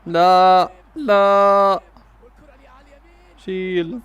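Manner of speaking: loud, long-held "laaa"; drawn-out cry of no. Real, not acted. Fan protesting at the match, loud drawn-out cry. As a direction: wail it out loudly. Reacting to a missed chance.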